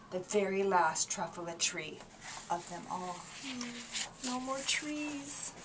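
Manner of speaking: storyteller hushing over the last tree; hushed. Acted. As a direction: let it fade almost to nothing.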